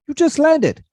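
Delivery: put-on very high, loud amused African accent; an incredulous African driver. Driver marvels he just landed, high amused African accent. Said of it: "I'll probably do a little bit of it".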